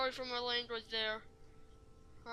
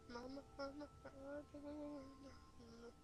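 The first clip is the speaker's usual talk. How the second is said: soft frightened whimpering, much quieter; scared whimper; 2 semitones higher, 14 dB quieter than usual. Real, not acted. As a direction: whimper for mama under your breath. Scared gamer whimpering for mama.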